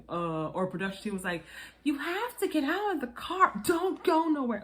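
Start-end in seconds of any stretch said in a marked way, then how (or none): 1.8-4.6 high, urgent imitation of barked orders; mock yelling. Production crew ordering her out of the car, mock yelling. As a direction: imitate a crew shouting instructions, pitched high. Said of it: "Now they're yelling at you".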